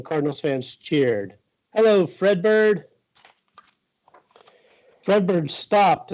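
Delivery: high-pitched, measured storybook reading; children's story read-aloud. Acted. Reads picture book aloud.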